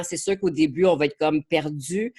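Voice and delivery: normal talking voice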